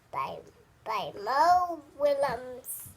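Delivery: ordinary delivery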